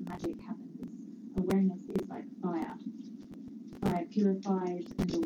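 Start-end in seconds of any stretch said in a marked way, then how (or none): 0.1-0.9 whispered. Real, not acted. Teacher evoking wonder, hushed.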